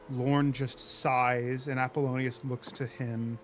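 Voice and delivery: normal talking voice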